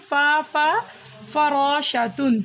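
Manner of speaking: فراشتن stretched out syllable by syllable; very slow reading. Shown as a demonstration. Teacher reads the word for the child, very slowly. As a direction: drag the word out so the child can follow. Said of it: "ہم اس کو کیسے پڑھیں گے".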